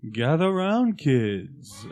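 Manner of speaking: drawn-out, swooping announcer call. Pretend. Voice actor trying out the commercial's announcer for a colleague, announcer voice. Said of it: "talk over that in your announcer voice".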